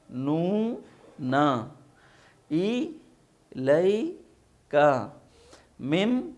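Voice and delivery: slow, separated syllables, one by one; syllable reading drill. Shown as a demonstration. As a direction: spell out each syllable like a teacher. (ہجے کر کے آہستہ پڑھائی)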